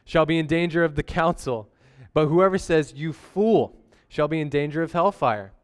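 measured scripture reading. Acted. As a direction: read the Bible passage with weight. Reads scripture passage aloud.

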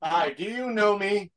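slow, soft, high-pitched pitchman parody; mock ad spokesman. Co-host spoofing a card advert, mock spokesman. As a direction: ask it slowly and high, like a spoof commercial.

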